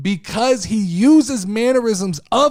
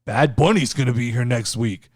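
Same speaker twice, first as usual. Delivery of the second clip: imitating Damian; 6 semitones lower than usual. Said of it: "Damien starts by saying".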